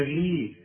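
غي with a long ee; kasra ghayn. Shown as a demonstration. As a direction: stretch غي on an ee vowel. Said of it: "لو مكسورة تبقى". (غي بغين مكسورة)

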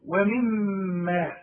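very slow ومما with a long humming m; doubled meem. Shown as a demonstration. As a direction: hold the nasal mm in ومما, wa-mimmm-maa. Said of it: "كل ميم في القرآن ما دام عليها الشدة".

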